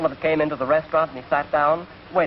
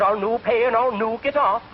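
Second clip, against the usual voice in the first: stubborn, slower Scottish refusal; Scottish accent; 7 semitones higher than usual. Retold or imitated. Scot refusing the conductor, stubborn Scottish accent. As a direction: dig in like a Scot who won't budge. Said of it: "The Scot says".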